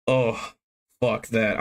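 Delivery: ordinary talk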